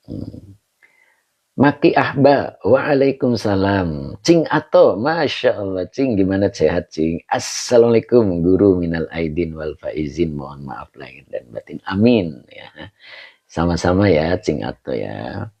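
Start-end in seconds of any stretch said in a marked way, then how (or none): none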